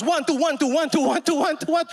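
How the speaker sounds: high, quick-fire counting; rapid drill count. Retold or imitated. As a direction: count like a spin instructor on a doubled beat. Teacher counts the doubled beat.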